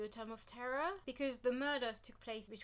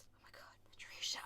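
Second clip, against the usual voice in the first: soft unvoiced disbelief; exasperated whisper; 9 dB quieter, faster than usual. Real, not acted. Vlogger whispering her exasperation at Patricia. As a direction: sigh it out under your breath. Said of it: "whispered exasperation at author".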